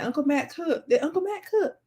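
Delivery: lightly voiced cousin, pitch lifted; imitating cousin. Cousin asking whether Uncle Matt cooked, lightly imitated.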